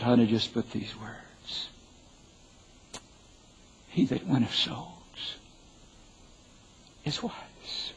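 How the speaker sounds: slow, hushed and earnest; sincere, solemn. Retold or imitated. Husband gives wife his true epitaph, quiet and solemn. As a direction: soften right down, leaving weight between phrases. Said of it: "Without being overly pious or super righteous".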